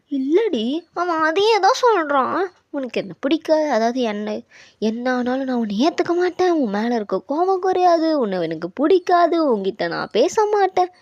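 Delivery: worked-up, sing-out list of grievances; exasperated retelling. Acted. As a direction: pitch it up and recite his rejections in frustration. Retells his rejection to friend, upset.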